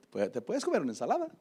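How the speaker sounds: ordinary delivery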